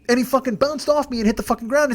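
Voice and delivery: ordinary talk, nothing marked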